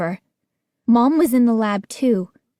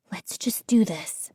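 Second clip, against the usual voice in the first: whisper; 6 dB quieter than usual. A narrator's reading. To Gates on the basement stairs, a tense whisper. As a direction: whisper it, voiceless and hushed.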